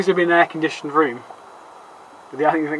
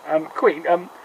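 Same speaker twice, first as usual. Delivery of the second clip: usual speaking voice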